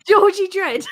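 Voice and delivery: high-pitched, jokey English voice; English accent. Pretend. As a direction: put on a squeaky English urchin accent. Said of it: "in an English voice there".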